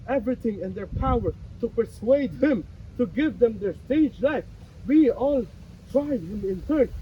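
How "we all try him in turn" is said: rising accusation. Acted. Accusing the author, pointing each.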